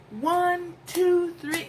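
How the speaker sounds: high, loud counting; reenacting the delivery-room push count. Counting the pushes.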